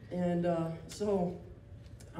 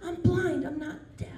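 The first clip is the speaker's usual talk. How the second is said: exasperated retort; 8 semitones higher than usual. Retold or imitated. Retorting to overbearing strangers.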